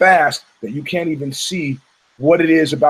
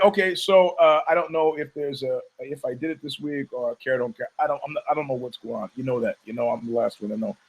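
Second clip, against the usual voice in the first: usual speaking voice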